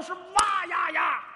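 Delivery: long, loud, high roaring wa-ya-ya battle yell; Zhang Fei's war cry. Shown as a demonstration. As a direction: let out a wild warrior's roar. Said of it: "怪叫". (张飞哇呀呀怪叫，高声长吼)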